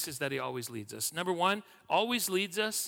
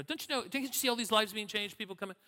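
mock protest; 6 semitones higher, faster than usual. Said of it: "It was a little bit like that".

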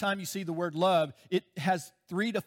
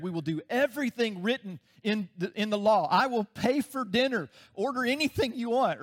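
higher, louder pompous promise; mock arrogance; 4 semitones higher, 3 dB louder than usual. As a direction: puff up like you can pay for everything. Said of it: "It was such arrogance".

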